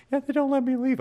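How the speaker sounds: very high little mock-kid voice; hammed-up plaintive squeak; retold pitiful kid. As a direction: imitate a small child held captive at work. Joking retold child captive worker.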